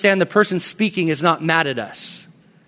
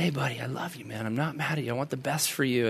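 low, hushed, loving dad voice; retold soft comfort to a child; tender reassurance; 5 semitones lower, 13 dB quieter than usual. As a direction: soothe him quietly and sincerely. Retold dad reassuring his son.

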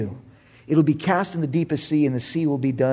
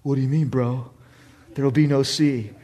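bummed surfer; 4 semitones lower than usual. Retold or imitated. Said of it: "surfers get a little bummed".

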